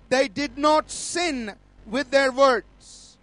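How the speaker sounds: very slow, weighted delivery of a divine message; slow, emphatic pronouncement. Retold or imitated. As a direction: spell it out word by word with long stresses. Voicing the Lord's solemn message in dream.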